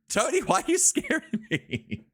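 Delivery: high, laughing through it; laughing complaint. Real, not acted. Streamer laughing at a viewer who scared her. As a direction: giggle the complaint.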